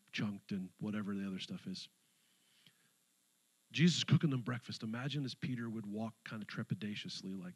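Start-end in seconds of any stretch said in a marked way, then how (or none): none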